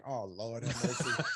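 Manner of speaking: a touch of nervous dread, laughing; mock dread. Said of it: "So I'm like".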